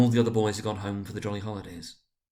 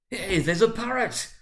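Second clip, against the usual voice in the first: much higher, slow delighted cry; excited cry; 12 semitones higher, 3 dB louder, slower than usual. A narrator's reading. Scrooge spots the storybook parrot, crying out excitedly. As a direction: point it out like a thrilled boy. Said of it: "cried Scrooge".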